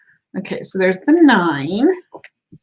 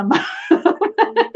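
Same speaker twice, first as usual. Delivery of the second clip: laughing; 12 semitones higher than usual. Real, not acted. Cracking up at own failed accent.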